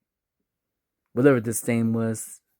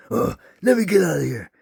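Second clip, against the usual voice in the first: higher, strained cry to escape; panicked old man; 6 semitones higher, 4 dB louder, faster than usual. Acted. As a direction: act out a trapped man's panic. Trapped old man tries doors.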